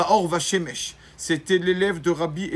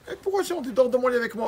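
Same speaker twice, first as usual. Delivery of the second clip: high brushing-off question; 7 semitones higher, faster than usual. Acted. Rabbi brushing off the mother's shame.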